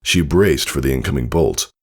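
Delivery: usual speaking voice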